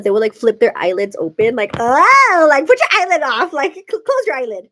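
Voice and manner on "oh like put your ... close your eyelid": loud, high mock squawk of disgust; grossed-out yell. Recalling kids flipping their eyelids at school, grossed-out yelling. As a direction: exclaim loudly, breaking toward a laugh.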